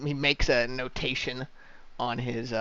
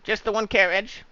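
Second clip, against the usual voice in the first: dwarf clerk; 7 semitones higher than usual. Acted. Dwarf questions Thane.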